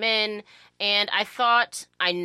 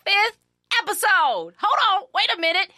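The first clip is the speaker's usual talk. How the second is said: much higher and louder, bursting with excitement; excited old lady voice; 13 semitones higher, 8 dB louder than usual. Acted. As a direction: cut loose like an old lady shouting praise.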